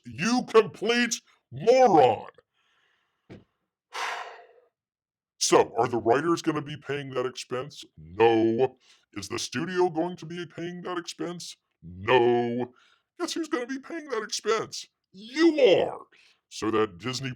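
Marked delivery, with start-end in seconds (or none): 0.0-2.3 slow, loud, high insult; exasperated yell at a fool; angry outburst. Real, not acted. Ranting at the quoted producer. 13.2-16.0 spring the answer on the listener; mock quiz reveal. Real, not acted.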